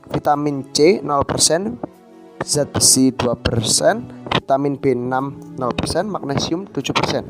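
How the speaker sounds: ordinary delivery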